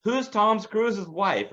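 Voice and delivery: asking the phone. Retold or imitated. Neighbor asking Google during the movie, mock slow voice. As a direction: drag it out, imitating the neighbor. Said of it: "It's like".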